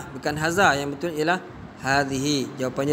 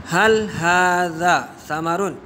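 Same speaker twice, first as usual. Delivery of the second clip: slow model question; slower than usual. Shown as a demonstration. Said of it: "Adakah ini buah apple?".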